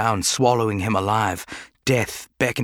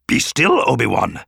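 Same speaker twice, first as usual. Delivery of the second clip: commanding Yoda; 4 dB louder than usual. Acted. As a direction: stop him moving, right now. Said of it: "Yoda commanded".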